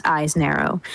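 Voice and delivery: ordinary talk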